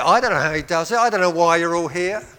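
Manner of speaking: usual speaking voice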